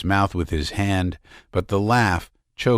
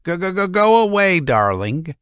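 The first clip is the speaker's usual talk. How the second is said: laughing and crying; 10 semitones higher, 3 dB louder than usual. A narrator's reading. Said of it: "laughing and crying".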